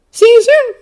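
put-on high, loud boy's voice; Peter, cheerful. A narrator's reading. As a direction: sign off perkily as an eager kid. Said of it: "Peter says again a little more cheerfully".

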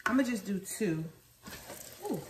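usual speaking voice

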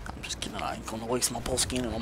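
unvoiced whisper; whispered aside. Real, not acted. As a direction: breathe it as an aside.

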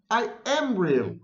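high, slow insistence on "am"; understated upset, slow and high; tearful protest. A narrator's reading. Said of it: "and began to cry".

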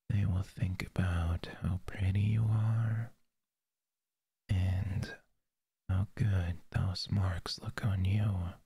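breathy close-mic whisper. Acted. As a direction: whisper it right into the ear. Whispered roleplay.

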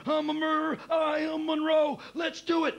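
high, full-throated roar of self-motivation; shouted high and loud, working himself up; psyched-up shout. Acted. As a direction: yell it to pump yourself into the role.

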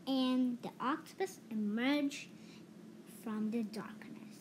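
ordinary delivery

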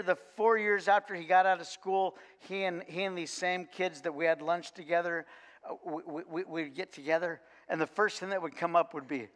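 ordinary talk, nothing marked

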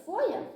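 Hutsul dialect фоя, plain f sound at the start; Hutsul dialect word. Shown as a demonstration. Student showing dialect pronunciation фоя. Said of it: "Наприклад".

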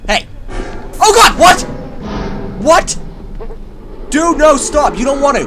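very loud high frightened yells; panicked scream. Real, not acted. Gamer attacked by monster, screaming in panic.

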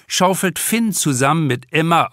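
plain reading voice

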